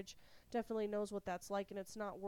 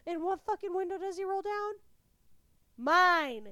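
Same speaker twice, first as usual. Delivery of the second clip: high, loud outraged disbelief; freaking out; 9 semitones higher, 10 dB louder than usual. Retold or imitated. Wife recounts husband opening her window, freaking out. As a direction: land the answer loud, in indignation. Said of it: "I am fucking freaking out".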